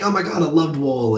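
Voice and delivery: mildly gushing, quoting a thrilled reader; retold freak-out. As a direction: lightly mimic someone freaking out. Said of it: "she's, like, freaking out".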